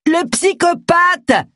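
slow, high-pitched furious roar. A narrator's reading. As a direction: roar it to cut them off. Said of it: "Rugit Agatha".

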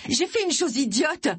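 high confession called through a door; high, urgent call. A narrator's reading. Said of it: "lança Agatha".

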